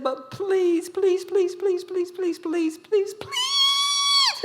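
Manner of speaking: high squealing begging, rising to a shout; squealing plea. Acted. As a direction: beg like a desperate little brother, squealing. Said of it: "Okay, stop the squealing".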